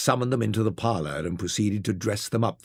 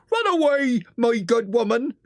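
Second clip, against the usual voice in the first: high, forceful order to leave; stiff, haughty sergeant; 11 semitones higher, 3 dB louder than usual. A narrator's reading. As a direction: dismiss a washerwoman as a haughty sergeant. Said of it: "the sergeant said to me".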